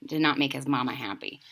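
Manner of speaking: ordinary delivery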